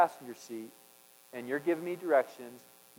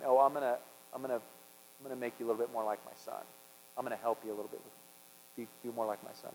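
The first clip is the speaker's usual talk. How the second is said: soft, hushed, lower and quick; tender reassurance; 3 semitones lower, 8 dB quieter, faster than usual. Retold or imitated. God gently promising to make him more like his son, hushed and tender. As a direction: speak it gently like a loving father.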